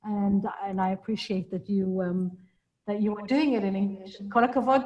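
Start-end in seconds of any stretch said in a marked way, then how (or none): none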